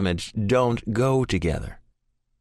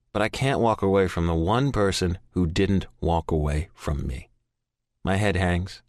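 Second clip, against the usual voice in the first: ordinary delivery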